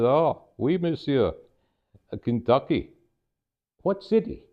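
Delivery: put-on rough French accent, politely inquiring; head waiter with an amateur French accent; attempted French accent. Maitre d' asking about Kentucky, attempted French accent. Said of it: "Forgive my French attempt here".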